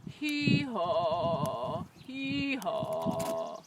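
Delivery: low, slumping hee-haw; tired donkey. A narrator's reading. Donkey worn out after walking all day, weary braying. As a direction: bray wearily, sinking in pitch. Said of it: "started to sound more like this".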